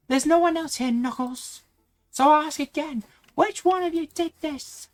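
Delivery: put-on high, upset girl's voice; Amy voice. Amy accusing the group over the hurt Sonic, high and upset. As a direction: accuse them, hurt and angry.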